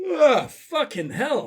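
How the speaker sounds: slow words through a yawn. Real, not acted. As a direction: say it mid-yawn. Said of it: "am I yawning?".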